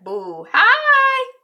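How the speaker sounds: loud high call. Retold or imitated. Randall reacts on the phone.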